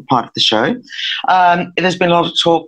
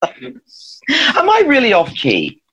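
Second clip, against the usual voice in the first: high mock hurt; 9 semitones higher, much slower than usual. Real, not acted. Playful mock hurt question.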